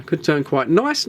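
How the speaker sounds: ordinary delivery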